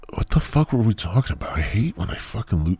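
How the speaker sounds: normal talking voice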